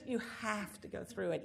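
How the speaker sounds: normal talking voice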